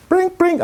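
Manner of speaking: high sung "bring, bring" like a ringing phone; playful ringtone impression; phone ring imitation. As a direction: chirp it like a cell phone ringing. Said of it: "my cell phone rings".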